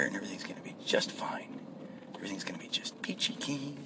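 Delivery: whispered, barely audible pep talk; whispered self-reassurance. Pretend. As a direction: whisper comfort to yourself. Pep talk to himself before work.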